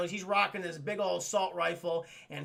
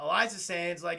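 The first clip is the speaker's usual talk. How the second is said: usual speaking voice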